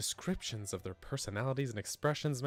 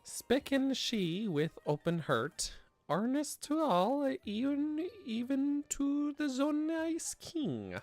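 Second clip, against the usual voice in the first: high strained reading; 9 semitones higher, much slower than usual. Real, not acted. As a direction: voice climbing high on hard words. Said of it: "your voice gets all high when it's harder to read".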